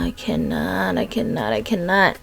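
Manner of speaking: soft, spent repetition; exasperated and quiet. Real, not acted. Giving up on the screenshots, quietly exasperated. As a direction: trail it out under the breath. Fed up after groaning.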